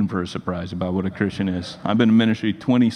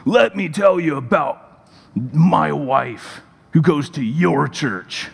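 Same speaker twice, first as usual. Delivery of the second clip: slow, emphatic, confrontational phone voice; angry husband; 4 semitones higher, much slower than usual. Retold or imitated. Big construction worker confronting youth pastor, slow mock anger. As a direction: imitate him laying out each word slowly, pitched up.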